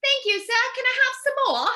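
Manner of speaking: high-pitched mock British orphan; stagey, high British begging voice; British Oliver urchin. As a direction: plead like Oliver in a bad British accent. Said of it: "walked off the Broadway play Oliver".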